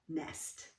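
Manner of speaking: usual speaking voice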